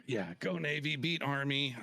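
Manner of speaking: mock cheer. Rival service cheer.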